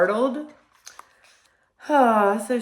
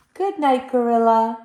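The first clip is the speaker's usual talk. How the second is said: a light hint of a tiny mouse, higher; little mouse voice; 5 semitones higher, 7 dB louder than usual. Little mouse bids the gorilla goodnight, lightly squeaky. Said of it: "says the little mouse".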